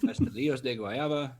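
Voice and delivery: Spanish flavour name with a laugh; fluent Spanish, lightly amused; Spanish accent. Real, not acted. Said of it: "Spanish accent".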